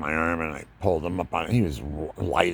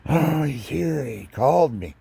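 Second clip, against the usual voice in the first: panicked; 10 semitones higher, 5 dB louder than usual. Retold or imitated. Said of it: "I'm panicking".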